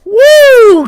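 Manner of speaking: high, loud victory yell; triumphant whoop. Real, not acted. Cheering after proving to his friend the book is real, triumphant whoop. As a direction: whoop like you just scored.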